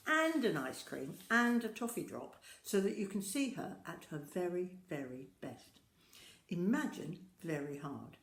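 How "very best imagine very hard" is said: plain reading voice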